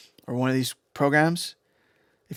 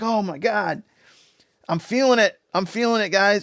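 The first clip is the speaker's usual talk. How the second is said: lightly voiced hyped-up fan, higher pitched; mock ecstatic raver; 6 semitones higher than usual. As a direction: play an overexcited crowd member who feels the drop. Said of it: "They're like".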